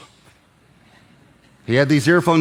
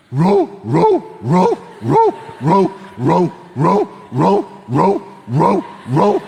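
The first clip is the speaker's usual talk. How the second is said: dog barking; 5 semitones higher, much slower than usual. Retold or imitated. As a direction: bark like a dog at night. Imitating dog barking at night.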